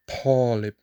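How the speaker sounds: teacher saying talib, emphatic T. Shown as a demonstration. Teacher contrasting emphatic T in talib. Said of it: "compare the two sounds again".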